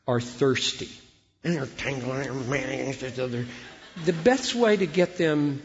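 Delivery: ordinary talk, nothing marked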